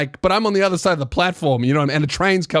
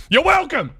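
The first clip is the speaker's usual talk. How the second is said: abrupt, high-pitched mock yell; scary over-intense reply; 9 semitones higher than usual. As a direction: blurt it out far too fiercely. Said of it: "I scare the shit out of her".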